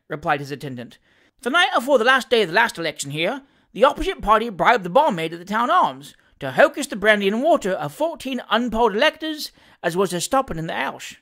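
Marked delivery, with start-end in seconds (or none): 1.4-11.1 animated Cockney manservant, higher-pitched; lively dialect servant reporting with relish; Sam Weller, cheeky manservant. Acted. Valet telling his master of the election, animated Cockney.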